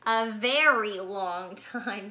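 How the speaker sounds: drawn-out. Acted. Mother bear tells cub the wait is very long, drawn out. Mommy stretches out long time.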